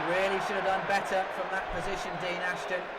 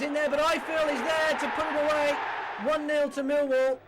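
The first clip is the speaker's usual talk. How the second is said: louder and higher as the ball goes in; rising to the goal, then announcing it; goal call; 7 semitones higher, 5 dB louder than usual. Real, not acted. Millwall score.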